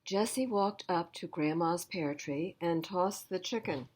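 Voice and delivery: ordinary delivery, nothing marked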